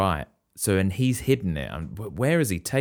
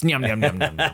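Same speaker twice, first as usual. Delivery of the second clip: munching noises; 5 semitones higher than usual. Pretend. Mock eating noises.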